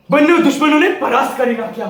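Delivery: fast, high, loud battle account; battle fervour. Acted. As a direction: charge through the words. Wounded soldier recounts defeating enemies.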